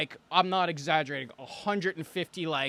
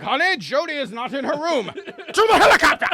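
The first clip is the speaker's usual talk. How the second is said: mock rich parent; 9 semitones higher, 10 dB louder than usual. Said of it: "It's like".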